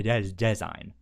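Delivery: normal talking voice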